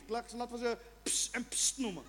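ordinary delivery

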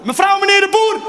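high, loud call outdoors; calling out cats. Pretend. Owner calling the cats from outside, high and loud. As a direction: call the cats home from the street. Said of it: "als je ze buiten gaat roepen".

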